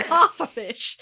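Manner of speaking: laughing echo; amused repeat. Real, not acted. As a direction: say it back with delight. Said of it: "amused repeat".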